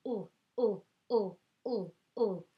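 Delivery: little pleased ooh while licking; lollipop ooh. Shown as a demonstration. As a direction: coo ooh over a lollipop. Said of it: "sounds like ooh".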